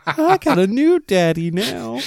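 high, slow, laughing. Real, not acted. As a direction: say it through laughter. Joke while laughing.

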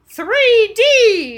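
loud, high, drawn-out holler; screamed exclamation. Retold or imitated. Friend re-enacting screaming out at a 3D movie, loud scream. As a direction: yell it out like an excited moviegoer. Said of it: "kept screaming out".

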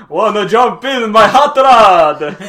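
greaser voice. Pretend. Greaser offering a ride.

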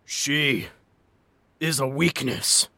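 a dying monster gasping, slow; gasped dying words. A narrator's reading. Crushed monster to Jack, gasping slowly. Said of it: "the monster gasped".

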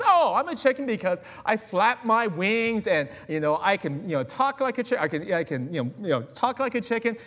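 high, slowed, hammed-up chicken voice; chicken character. Chicken explaining himself in the skit, high and hammy. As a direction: ham up the proud chicken. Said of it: "He says".

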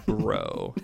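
dude-bro "Bro" pitched far down; low "bro" voice. Shown as a demonstration. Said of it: "put out that voice".

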